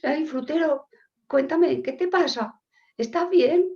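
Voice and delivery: concerned policeman. Acted. Policeman checking on the fruit seller, high acted concern. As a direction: fuss over him with exaggerated concern. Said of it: "El policía va al frutero".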